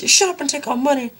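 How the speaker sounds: high-pitched exclamation; mock urgent cry. Funders cutting off the question, mock urgent high cry. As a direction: blurt it high to cut him off. Funders cut vampire off in parody.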